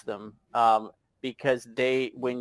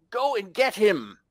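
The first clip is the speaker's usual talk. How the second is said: higher, firm command with a touch of uncertainty; sergeant's order; 8 semitones higher than usual. Acted. Sergeant to two reluctant guards, giving a mildly insistent order. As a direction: order your men forward. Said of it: "the sergeant says".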